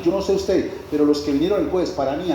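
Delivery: ordinary talk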